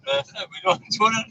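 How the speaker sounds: high, lilting put-on Welsh accent; mock Welsh accent. Man keeps up the Welsh voice while his mate teases him, high. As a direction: play a cheeky Welshman, pitched well up. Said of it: "If you're gonna do Welsh".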